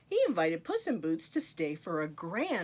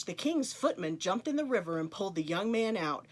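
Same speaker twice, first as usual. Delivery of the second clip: ordinary delivery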